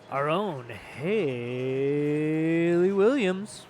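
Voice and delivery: slow, booming arena announcer. Shown as a demonstration. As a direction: stretch the name out like a ring intro. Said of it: "That was my, uh, announcer voice".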